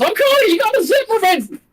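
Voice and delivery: high, fast cartoon cricket voice; chirpy little cricket, high and quick; Jiminy Cricket voice. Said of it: "that's the best Jiminy Cricket voice I can do".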